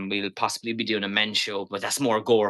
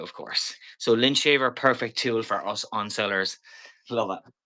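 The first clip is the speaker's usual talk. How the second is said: usual speaking voice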